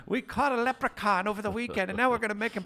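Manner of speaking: high, loud Irish leprechaun voice; squeaky Irish leprechaun announcer. Acted. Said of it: "Irish do a rocket one".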